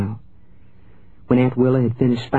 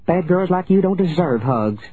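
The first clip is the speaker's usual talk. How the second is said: high-pitched, cross aunt; shrill scolding; 7 semitones higher than usual. A narrator's reading. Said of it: "Aunt Willa shrilled".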